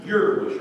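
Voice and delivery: "Your voice" with "Your" loud and high; stress on your. Shown as a demonstration. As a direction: hit "Your" hard. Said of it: "He puts special emphasis on it there".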